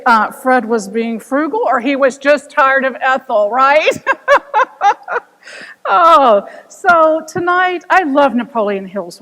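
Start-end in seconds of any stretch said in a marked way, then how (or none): 3.9-5.2 high burst of laughter. Real, not acted. Teller laughing with audience, high laugh.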